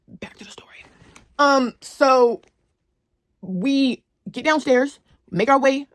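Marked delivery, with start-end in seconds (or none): 0.1-0.8 soft unvoiced whisper to viewers; whispered aside. Real, not acted. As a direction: whisper it like a secret.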